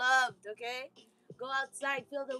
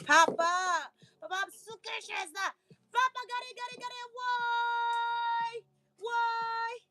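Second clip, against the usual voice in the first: put-on high, childlike calls for Papa; childish calling; slower than usual. Playfully pretending to be a curious child calling Papa. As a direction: call out to Papa like a curious little kid.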